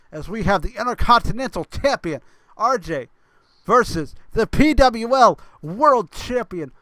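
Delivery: big main-event build, names stretched and loud; hyping the match. Real, not acted. Commentator hyping champion versus champion for viewers, excited announcer hype. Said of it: "I need to hype this match".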